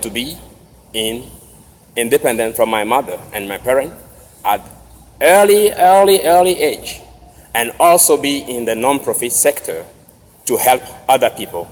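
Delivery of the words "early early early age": early, early, early said louder and higher each time; stress on early. Real, not acted. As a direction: hit every early hard and high. Repeats word for emphasis.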